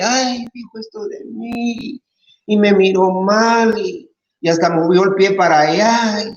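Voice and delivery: slow, high, whiny mock grievance; touchy complaint. Mocking oversensitive churchgoer complaining.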